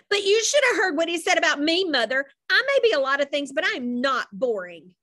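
put-on girl protesting hotly to her mother; indignant protest. A narrator's reading. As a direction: insist you were wronged.

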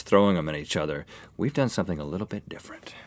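fast breathy whisper. Real, not acted. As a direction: hush it out quickly. Whispered aside.

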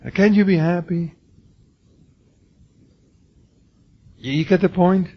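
high, loud jeering voice, lightly put on; mock scolding critic. Preacher voicing critics who mock a weeping elder, loud taunting. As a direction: throw the critics' taunt back at him. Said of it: "Is he a killjoy?".

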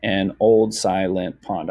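slow, counted-out reading; measured beat on every syllable. Shown as a demonstration. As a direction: read it slowly, counting each syllable. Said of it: "if we read it out loud".

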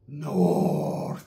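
drawn out, low and soft; low slow-motion greeting. Retold or imitated. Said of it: "All in slow motion".

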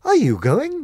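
high, loud astonished question; sudden surprise; surprised. Acted. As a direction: caught off guard. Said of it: "they asked in surprise".

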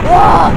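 short, loud, high mock shout; play-acted fighting cry; sudden high yell as a figure; pretend battle yell. Ice giants fighting in play.

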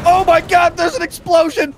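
high, startled outcry; alarmed. Pretend. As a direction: cry out at a sudden blast. Reacting to explosion in game.

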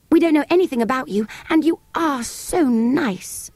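put-on butter him up like a sweet child; flattering child. Jane flattering the sand fairy, high and coaxing.

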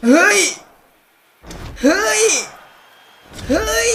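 real thrill at a big pull; excited shouts. Real, not acted. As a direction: scream in rising disbelief. Big card reveal.

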